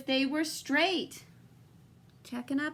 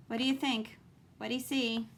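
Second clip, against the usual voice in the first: ordinary delivery, nothing marked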